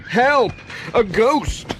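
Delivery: high, startled cry of help; mock alarmed shout. A narrator's reading. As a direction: yelp it out like he's seen a ghost. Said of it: "shouted Pat".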